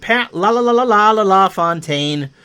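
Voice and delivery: excited goal call, rolling the "la-la-la" into the surname; Rick Jeanneret. Retold or imitated. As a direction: stutter the name out in a rapid, ringing run. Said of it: "That is the Rick Jeanneret".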